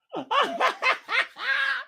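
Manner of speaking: high, sudden laugh; burst of laughter. Real, not acted. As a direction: let out a surprised laugh. Sudden laugh mid-broadcast.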